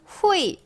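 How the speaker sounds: 후이 as "hu-i" with no e; 후이 said high, jumping from u straight to i; wrong reading. Shown as a demonstration. Teacher showing beginners' mistake, wrong reading. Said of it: "우이 아니에요".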